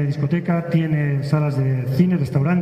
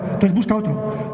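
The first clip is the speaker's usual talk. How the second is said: usual speaking voice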